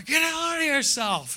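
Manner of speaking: high, drawn-out mock scolding; slow, high-pitched telling-off; scolding wife. Wife scolding him, retold.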